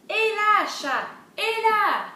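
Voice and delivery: high, loud, drawn-out call; storybook summons at full voice; calling the cat. Acted. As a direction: holler for the cat. Dog calls the cat in story.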